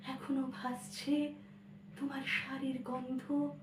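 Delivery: elegiac poetry recitation. Acted. Child alone with mother's cassette, wistful recitation.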